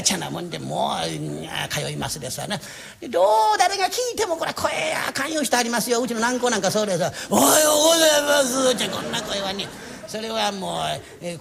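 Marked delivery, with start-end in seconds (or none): 3.0-8.7 high, strained greeting; mock the tuneless voice; bad voice. 声の悪い人の物まね. (声の悪い人の物まね)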